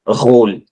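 गोल opened with a throaty mix of r and kh; French guttural R. Shown as a demonstration. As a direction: rasp the first sound of गोल in the throat. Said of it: "mixture of र and ख". (गोल की शुरुआत र और ख के मिले-जुले स्वर से)

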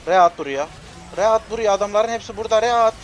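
very loud, high urgent yelling; frantic shout. Real, not acted. Gamer screaming at his teammate to use ult now, frantic shout. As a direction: scream the order at him.

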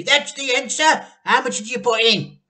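high, loud eager questions; excited. A narrator's reading. Mr Cranky excited at the answer, high eager voice. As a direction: rush the words, thrilled.

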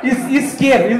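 "isqueiro" broken after a loud "Is-"; loud demonstration, restarting on "Is-"; loud word drill. Shown as a demonstration. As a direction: teach "isqueiro" loudly, first syllable apart. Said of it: "vou tirar o microfone".

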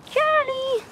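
high, drawn-out call of the bunny's name. Real, not acted. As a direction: sing his name out high to fetch him. Calling the bunny from afar.

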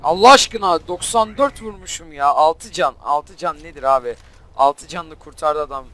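loud, incredulous protest; exasperated outburst. Real, not acted. Gamer protesting that the enemy survived on six health, exasperated. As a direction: burst out loudly, then keep complaining.

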